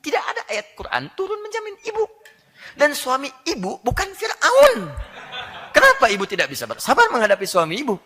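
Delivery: high, loud, half-laughing rebuke; laughing scold. Acted. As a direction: tease her hard while pressing the point. Preacher scolding the wife.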